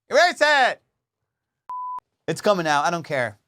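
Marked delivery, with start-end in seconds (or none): 0.1-0.8 much higher, louder frantic demand; real panic. Real, not acted. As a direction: cry out to delete it, alarmed. Panics about incriminating recording.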